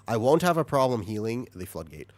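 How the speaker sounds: ordinary talk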